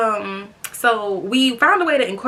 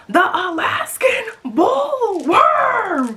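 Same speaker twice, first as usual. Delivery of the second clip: slow, high, booming horror-movie announcement; SpongeBob-style dramatic bullworm shout; dramatic SpongeBob quote; 12 semitones higher, 5 dB louder, much slower than usual. Pretend. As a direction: boom it out like a cartoon monster reveal. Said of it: "The thing that SpongeBob thought".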